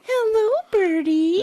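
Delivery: pet greeting. Real, not acted.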